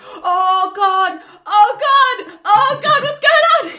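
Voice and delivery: hammed-up panicked cries, laughing at the end; shrieking in mock alarm at the twist. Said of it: "And I'm like".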